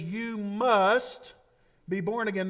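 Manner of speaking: "must" lifted and held, then falling on "born again"; stress on must. Acted. Preacher pointing out the Greek verb, stressing "must". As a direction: lean into "must". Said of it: "a little verb in verse seven where he says".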